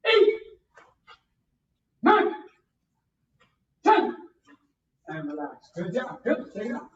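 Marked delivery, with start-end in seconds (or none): 0.1-4.2 nine, ten barked high and loud; shouted count. Shown as a demonstration. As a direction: snap each number out sharply. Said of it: "When you hear my voice, bang".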